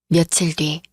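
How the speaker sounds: plain reading voice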